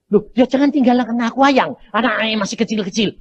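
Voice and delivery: wife begging husband not to die; pleading wife. Retold or imitated. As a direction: beg him not to go. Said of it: "wife pleading".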